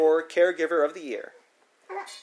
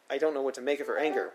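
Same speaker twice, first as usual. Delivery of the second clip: plain reading voice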